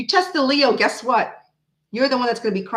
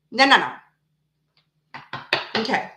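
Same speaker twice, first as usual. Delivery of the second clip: normal talking voice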